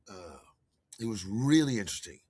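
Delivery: usual speaking voice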